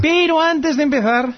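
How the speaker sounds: high, slow teasing imitation; mimicking a catchphrase. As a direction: parrot his stock phrase.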